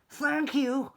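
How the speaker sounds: high squeaky dog voice; a little dog saying thanks; high small animal thanks; grateful dog. A narrator's reading. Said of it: "said the dog".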